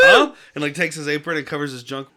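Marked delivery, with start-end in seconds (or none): 0.0-0.3 much higher, louder startled yelp; a shocked high huh. Retold or imitated. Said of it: "And he's like".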